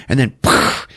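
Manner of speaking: ordinary talk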